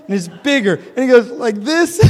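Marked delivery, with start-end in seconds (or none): 1.3-2.0 put-on very high, loud little-kid question; child asking. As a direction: ask like a small boy holding up hands. Child asking in retold story.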